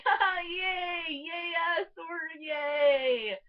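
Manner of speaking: high, loud fangirl yays; gleeful cheer. Retold or imitated. Movie geek watching homages, gleeful cheering. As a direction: cheer with giddy, mock-triumphant delight. Acts out geeky delight at homages.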